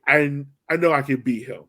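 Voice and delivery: ordinary delivery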